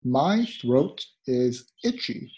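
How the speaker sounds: slow and clear for learners. Shown as a demonstration. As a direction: read it out slowly, word by word. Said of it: "slow teaching take".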